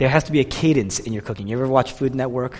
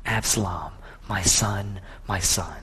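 low, hushed grief over each name; acted mourning, sinking softer with each phrase; David weeping; 6 semitones lower, 9 dB quieter, slower than usual. David cradling his dead son, weeping quietly. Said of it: "He's weeping. He's crying".

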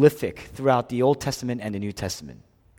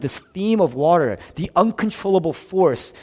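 ordinary delivery

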